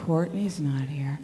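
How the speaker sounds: hushed, slow whisper. Real, not acted. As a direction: drop to a secret whisper. Hushed aside about absent guest.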